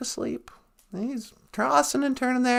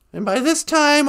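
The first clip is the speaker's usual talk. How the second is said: plain reading voice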